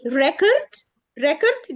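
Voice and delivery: record as REC-ord, heavy first syllable; first-syllable stress. Shown as a demonstration. Teacher modelling the noun, record stressed on first syllable. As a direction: lean on "rec" in record, weak ending. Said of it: "بنحط stress يعني بنتقل الجزء الأولاني".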